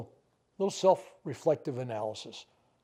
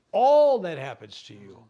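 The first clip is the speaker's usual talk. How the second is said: much louder, slow, hitting "All that happens to you"; loud repeat for emphasis; 11 dB louder, slower than usual. Shown as a demonstration. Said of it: "Thank, be grateful for all that happens to you".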